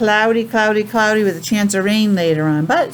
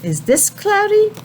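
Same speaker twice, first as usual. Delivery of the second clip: high rising playful question; singsong question to children; high questioning teacher voice; 10 semitones higher than usual. Shown as a demonstration. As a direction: ask it up high like to little kids.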